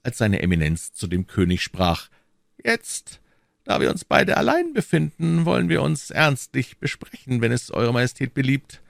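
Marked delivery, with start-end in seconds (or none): 2.6-8.8 much higher, smooth cardinal voice; silky churchman stepping back, high voice; cardinal's voice. A narrator's reading. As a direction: play the sly cardinal, pitched well up.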